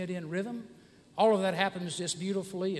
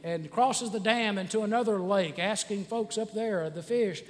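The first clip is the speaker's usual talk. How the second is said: ordinary delivery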